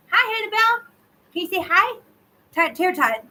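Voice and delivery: high coo to the kitten. Real, not acted. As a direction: greet the kitten sweetly. Greeting the kitten.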